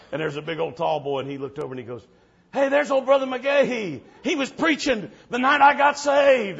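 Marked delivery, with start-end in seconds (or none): 2.5-6.6 high, loud, happy call out; excited recognition. Retold or imitated. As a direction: call out to an old friend. Tall boy recognizing the preacher.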